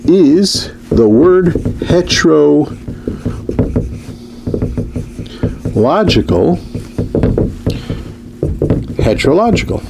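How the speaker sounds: is the word heterological drawn out very slowly, heterological rising high at the end; slow dictated question. Shown as a demonstration. Lecturer to class, dictating the question very slowly. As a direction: spell out the question slowly, as if writing it on the board. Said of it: "Question mark".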